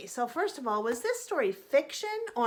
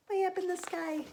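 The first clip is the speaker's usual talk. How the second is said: plain reading voice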